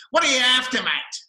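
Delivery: loud, intense Australian bark; broad Australian accent. Retold or imitated. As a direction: imitate a rough, intense Aussie bogan. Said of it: "in this very intense, broad Australian accent".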